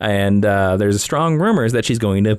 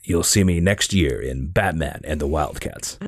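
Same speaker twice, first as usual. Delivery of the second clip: Batman voice; 8 semitones lower, 4 dB quieter than usual. Pretend. Host joking in his Batman voice about casting. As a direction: still in the low hero voice.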